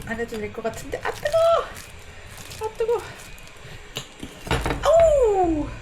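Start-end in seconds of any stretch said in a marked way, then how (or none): 1.0-5.7 very high yelp; hot yelp. Real, not acted. Burning hand on pumpkin.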